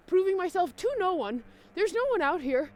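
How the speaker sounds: rising exasperated outburst; self-mocking rant. Real, not acted. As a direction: exclaim it, laughing at yourself. Ranting about biking for nobody.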